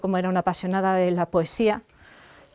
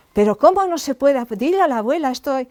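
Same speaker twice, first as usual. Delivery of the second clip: higher and louder, youthfully indignant; indignant teen; 5 semitones higher, 4 dB louder than usual. Retold or imitated. Teen daughter pleading with her mother, indignant and louder. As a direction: insist like an eighteen-year-old who thinks it is all easy.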